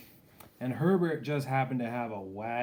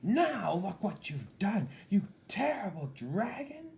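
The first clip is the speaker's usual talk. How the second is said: angry princess whisper; 10 semitones higher, 4 dB louder than usual. A narrator's reading. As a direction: hiss at the dragon in disgust. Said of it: "said the princess".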